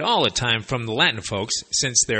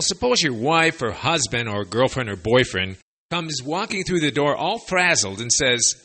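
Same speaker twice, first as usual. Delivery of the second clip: usual speaking voice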